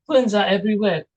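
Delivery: ordinary talk, nothing marked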